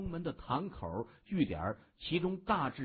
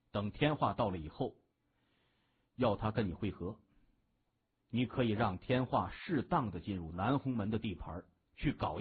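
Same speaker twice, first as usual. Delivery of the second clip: a touch lower, calmly assigning tasks; understated leader briefing his men in a lowered voice; lightly voiced gang boss; 6 semitones lower than usual. A narrator's reading. (略低沉、平稳布置任务的大哥)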